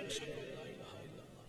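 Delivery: whispered testimony; very hushed, quick recitation; said quietly. Shown as a demonstration. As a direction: whisper it, barely audible. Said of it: "Say that quietly".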